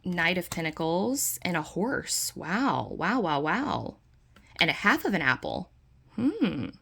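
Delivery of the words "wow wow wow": ordinary delivery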